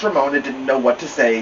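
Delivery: usual speaking voice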